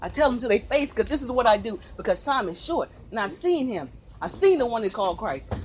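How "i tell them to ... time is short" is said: usual speaking voice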